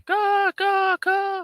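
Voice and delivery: very high, loud squawked caws; shrill mock bird caws; cawing bird. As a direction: caw like a cheeky bird. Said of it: "You mocking me".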